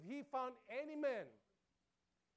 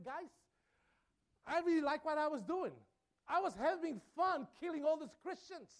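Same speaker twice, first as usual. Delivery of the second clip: louder, higher gloating persecutor voice; boastful Saul; 5 semitones higher, 5 dB louder than usual. Retold or imitated. Preacher voicing Saul boasting to the crowd, gloating. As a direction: crow like a proud zealot bragging about his fun. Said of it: "he was saying".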